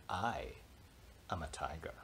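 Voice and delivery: soft unvoiced whisper; whispered defiance. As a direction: breathe it under your breath. Whispered claim.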